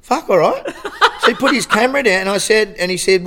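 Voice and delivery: high, loud and laughing through it; real laughter at the punchline. Real, not acted. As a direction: tell it while cracking up. Retelling the paparazzo giving in.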